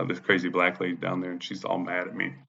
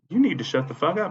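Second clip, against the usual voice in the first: high-pitched mimic of a scolding woman; mock her order in a raised voice; imitated scolding woman; 9 semitones higher than usual. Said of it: "she goes".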